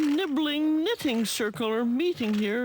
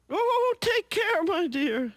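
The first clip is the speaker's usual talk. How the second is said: put-on high, slow sweet old granny; Granny comforting a sick friend on the phone, high and slow; 8 semitones higher than usual. A narrator's reading. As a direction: play a kindly grandmother, drawn out. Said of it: "says Granny".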